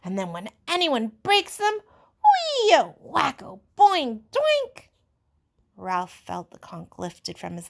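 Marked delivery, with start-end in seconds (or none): 0.0-1.8 loud, slow, eager call for rules; excited cry. Acted. Jack excitedly inventing punishments. 2.2-4.9 high, loud comic sound effects; mock whacking noises. Jack mimicking whacking noises.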